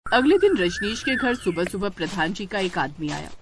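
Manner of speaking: ordinary delivery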